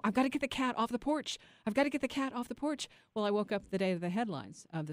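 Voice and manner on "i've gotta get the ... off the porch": high, hurried worry; urgent. Retold or imitated. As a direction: rush to save the cat. Urgent retold thought in dream.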